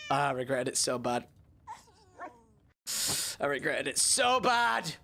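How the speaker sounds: a groan with a half sob; mock crying. Real, not acted. Reliving his awkward moment, half-sobbing groan. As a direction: whimper out the regret.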